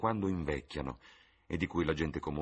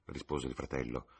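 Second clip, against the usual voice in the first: usual speaking voice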